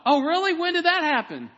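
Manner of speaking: high, loud, excited questioning; eager follow-up question. Retold or imitated. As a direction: act out an excited friend pressing for details. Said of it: "And then the follow-up".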